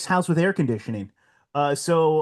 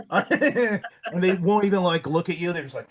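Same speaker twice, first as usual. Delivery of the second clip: ordinary delivery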